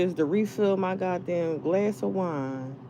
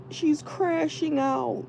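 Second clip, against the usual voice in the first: high, slow mock imitation of onlookers; mocking other people; 10 semitones higher, slower than usual. People online mocking her, high and slow. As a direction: drag it out like gossiping people. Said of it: "they'll be like".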